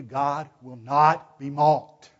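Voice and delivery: "God will not be mocked" very slow and loud; emphatic. Shown as a demonstration. Preacher warning would-be schemers, "God will not be mocked" slow and emphatic. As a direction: land each word of "God will not be mocked" heavily. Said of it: "Did you hear that emphatic voice behind it?".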